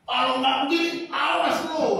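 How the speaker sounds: high, loud mock threat ending in a shout; threatening yell, pitched high. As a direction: snap a warning at God like a brat. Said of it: "ngancem-ngancem Tuhan".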